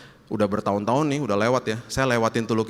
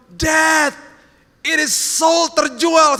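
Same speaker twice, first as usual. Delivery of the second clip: imitated child yelling, high and loud; excited child's shout; 8 dB louder, much slower than usual. Child spots the sold sticker and yells to Dad, shouting. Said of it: "Dia teriak dong".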